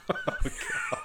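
sudden high burst of laughter; high loud laugh. Real, not acted. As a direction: laugh it off loudly. Laughing at own dark joke.

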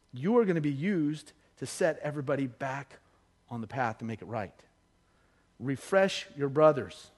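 low, soft, grave storytelling; hushed solemn preaching. Real, not acted. Preacher telling of Peter's denial, hushed and solemn. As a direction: let the voice drop and leave long pauses.

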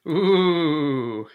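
spooky ghostly ooh; scary ghost. Acted. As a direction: moan it like a haunting ghost. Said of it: "That was supposed to sound like a scary ghost".

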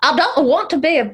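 high, put-on Scottish voice; Scottish accent. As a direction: try out a cartoon Scottish accent. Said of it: "That's where we start to go Scottish".

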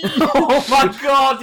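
loud, high, drawn-out exclamation; mock outcry. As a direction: cry out as if overwhelmed.

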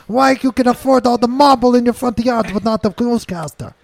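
mock Portuguese indignation, rising; fake Portuguese accent. Ranting about Portuguese puppet news, fake accent. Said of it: "racially ambiguous fucking accent".

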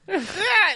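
high, loud laugh at his own punchline; burst of laughter. Real, not acted. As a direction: crack up laughing.

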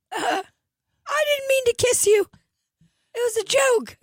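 very high squeal; squeaky cartoon reply. Mister Hanky answering Tony, very high squeak. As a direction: chirp it in a tiny cartoon voice.

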